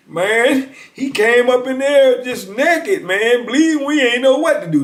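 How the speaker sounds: put-on high, loud disbelieving young guys; scared cellmates. Cellmates recalling the naked intruder, high and loud. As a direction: retell it wide-eyed and high.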